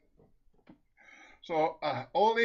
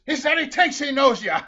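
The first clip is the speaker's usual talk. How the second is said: loud, raised-pitch answer; shouted relay; 12 semitones higher, 14 dB louder than usual. Acted. Husband reports to wife in the car, loud high shout. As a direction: shout it back to her.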